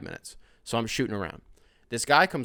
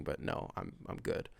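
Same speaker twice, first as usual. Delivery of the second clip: much softer, fading decline; trailing off; 6 semitones lower, 14 dB quieter than usual. Retold or imitated. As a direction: let it die away politely.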